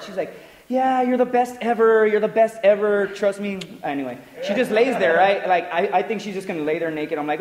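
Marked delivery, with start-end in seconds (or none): 0.7-3.6 high, loud mock girlfriend voice; girlfriend's praise. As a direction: gush in her higher voice, hammed up.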